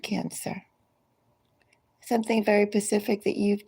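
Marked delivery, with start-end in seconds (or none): 0.0-0.6 breathy whisper of the sign's name; a hushed aside to the listener. Real, not acted. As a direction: whisper it like a secret. Tarot reader drops to whisper.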